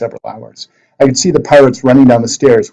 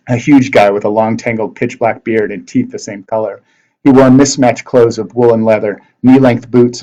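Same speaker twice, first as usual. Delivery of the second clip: usual speaking voice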